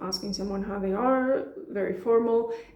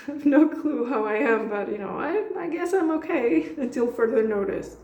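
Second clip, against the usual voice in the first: louder, higher, laughing mock reply; laughing paraphrase; 4 semitones higher, 4 dB louder than usual. Mock reply to the formal greeting, laughing and louder. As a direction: toss it off with a laugh. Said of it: "it's a way of saying".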